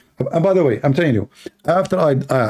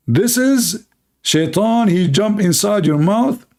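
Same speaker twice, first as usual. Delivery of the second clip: high and very slow, every word drawn out; slow dramatic reveal; 6 semitones higher, slower than usual. Acted. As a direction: unveil it like a grand revelation. Punchline of the Shaitan story.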